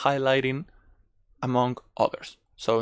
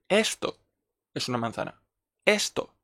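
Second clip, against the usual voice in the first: pointing out the apple among the oranges; stress on esto; 2 semitones higher, 3 dB quieter than usual. Shown as a demonstration. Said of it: "Estás reforzando el esto mucho más".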